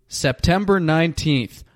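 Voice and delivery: ordinary talk, nothing marked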